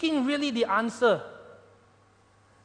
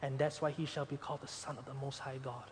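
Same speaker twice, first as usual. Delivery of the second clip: a soft, gentle angel voice, quieter and trailing away; speaking very gently; 5 semitones lower, 8 dB quieter than usual. Acted. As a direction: tell her tenderly, easing her fear. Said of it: "Gabriel spoke very gently".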